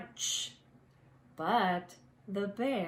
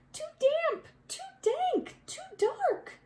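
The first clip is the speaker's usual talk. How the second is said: tiny mouse squeak, much higher; squeaky mouse voice. A narrator's reading. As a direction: squeak it out like a frightened little mouse. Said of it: "Mouse squeaks".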